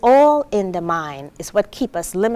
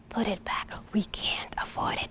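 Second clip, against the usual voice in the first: soft, stern whispered order; 12 dB quieter than usual. Retold or imitated. Mother to child in the empty aisle, stern whisper. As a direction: hush the child firmly. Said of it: "And then she's like".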